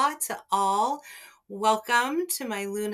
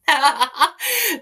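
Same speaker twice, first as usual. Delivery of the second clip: high, loud burst of laughter; self-amused laugh; 7 semitones higher, 7 dB louder than usual. Real, not acted. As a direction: laugh openly at your own slip. Laughs at own forgotten phone.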